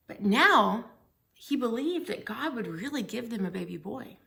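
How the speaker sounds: ordinary delivery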